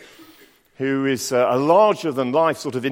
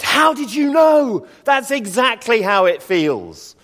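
much higher, louder, slow amazed exclamation; astonished relief; 11 semitones higher, 7 dB louder, slower than usual. Retold or imitated. Sick man answering the doctor, astonished relief. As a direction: burst out amazed he understood.

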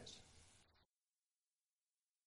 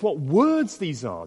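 raised pitch with emphasis on "words"; emphatic amazement; 4 dB louder, slower than usual. Retold or imitated. The amazed people react to Jesus, emphasis on "words". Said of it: "Do you see the emphasis?".

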